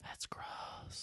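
whispered disgust. Real, not acted. Whispered aside.

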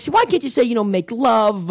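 high mock Italian mother coaxing; imitating mother. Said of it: "you think she would have been like".

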